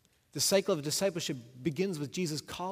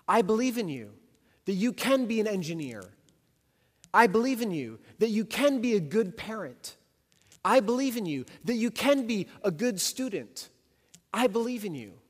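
rising encouragement; 6 semitones higher, 6 dB louder than usual. Acted. Preaching Jesus' encouragement, building.

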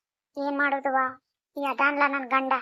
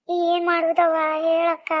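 slow, drawn-out crying lament; tearful wail; 2 semitones higher, much slower than usual. Acted. Wife crying to her neighbour about her troubles, tearfully. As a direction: wail it helplessly, stretching the words. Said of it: "ಎದಕ್ ಅಳಕತ್ತಿ".

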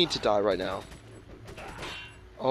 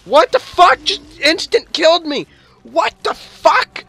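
high, loud, slow outraged yelling; furious outraged shriek; 13 dB louder, slower than usual. Real, not acted. Gamer killed instantly, screaming in furious disbelief.

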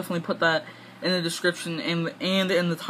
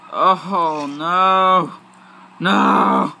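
loud, drawn-out cry of no; loud dismay; 8 dB louder, much slower than usual. Real, not acted. Player watching the game go wrong, loud dismay. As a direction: wail it out loudly in dismay.

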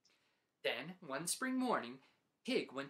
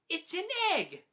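much higher and louder, stubborn insistence; insistent; 11 semitones higher, 7 dB louder than usual. Acted. Pig insists to Duck, high and loud. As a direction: put your foot down.

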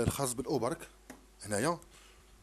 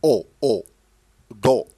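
دو called out much louder, crisp; loud model syllable; 4 semitones lower, 13 dB louder than usual. Shown as a demonstration. Teacher drilling a child on a syllable, loud. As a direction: hit دو hard so the child copies it. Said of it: "هذا يا دو".

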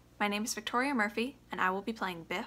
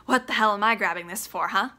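loud, raw, high shouting; furious son; 3 semitones higher, 5 dB louder than usual. Acted. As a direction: tear into his father. Biff rages at Willie.

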